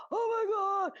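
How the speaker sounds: put-on high, loud panicked cry from a flustered old man; mock-panicked older driver yelling at a tiny bump; frantic old man. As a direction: squeal it like a man losing his head. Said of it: "he was going spastic".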